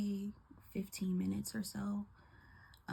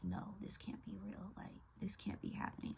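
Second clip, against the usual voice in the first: hushed, unvoiced disbelief; stunned whisper; 8 dB quieter than usual. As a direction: breathe it out as if you can't believe it. Inner disbelief at mom's cardiac arrest.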